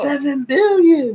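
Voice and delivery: high, slow drawn-out yell; shouting stranger. Retold or imitated. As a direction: stretch it out like the wild man. S1 voicing the beggar.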